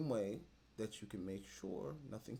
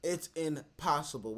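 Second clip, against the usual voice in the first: ordinary talk, nothing marked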